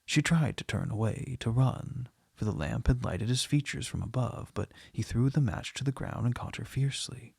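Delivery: ordinary delivery, nothing marked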